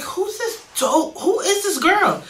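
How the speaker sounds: high, slow mock-surprised voice; retold mother's question. Retelling the mom's question in a high, slow voice. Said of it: "It's like".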